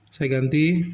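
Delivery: ordinary talk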